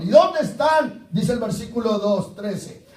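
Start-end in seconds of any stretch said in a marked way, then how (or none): 0.0-1.1 louder, higher, slow challenge; indignant Gideon. Acted. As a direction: demand it of God with indignation. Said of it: "Dice Gedeón".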